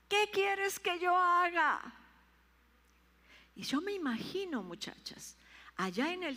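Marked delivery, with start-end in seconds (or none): 0.1-1.8 slow, high, loud surrendered plea; pleading cry. Acted. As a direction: cry out to the Lord, trembling. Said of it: "temblando y temeroso, dijo". (súplica fuerte, lenta y aguda)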